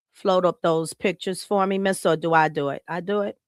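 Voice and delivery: ordinary talk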